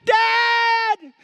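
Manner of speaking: very high, loud yell for dad; drawn-out mock-frightened shout; acted panicked cry. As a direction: cry out like a panicking little boy. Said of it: "began to panic, and he cried out".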